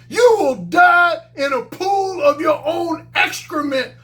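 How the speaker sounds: slow, high shouted menacing imitation; grim reaper voice. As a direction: shout it like a menacing tough guy. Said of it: "He said".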